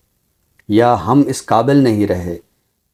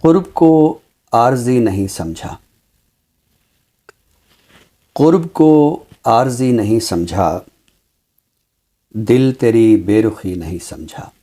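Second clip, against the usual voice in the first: measured mushaira-style poem reading; ghazal recitation. Acted. (शायराना अंदाज़ में ग़ज़ल पढ़ना)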